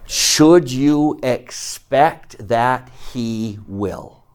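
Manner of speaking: very slow, weighted words, dropping away on "will"; deliberate rhetorical question, spaced out; slow, emphatic question. Real, not acted. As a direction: draw out each word to drive it home. Teacher drives the point home.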